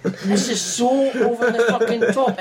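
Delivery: high, laughing through the words; laughing disbelief. Retold or imitated. Viewer cracking up at bad acting, high and laughing. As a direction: giggle it out in disbelief. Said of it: "And you're like".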